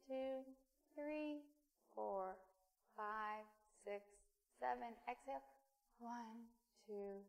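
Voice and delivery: soft, even counting voice; guided breathing count. Acted. Pastor counting the congregation through slow breaths, softly. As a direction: count them gently through each breath.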